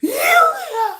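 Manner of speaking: high laughing squeal; stammering fangirl. Pretend. Fan stammering at her idol, laughing squeal. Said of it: "girl, I just".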